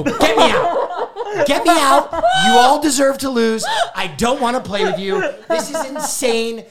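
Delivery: high, loud, hammed-up outburst; rage quit. Scolded newcomer voting himself out of the game, raging. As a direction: storm out in theatrical indignation. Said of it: "like, a rage monster".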